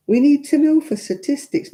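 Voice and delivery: high, mocking bureaucrat voice; mimicked official excuse. Officials justifying nosy forms, high mocking imitation.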